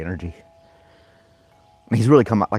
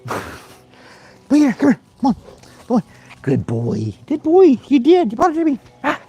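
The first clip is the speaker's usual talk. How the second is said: much higher and louder, gushing praise; high dog praise; 6 dB louder, slower than usual. Real, not acted. Praising dog fetching toy.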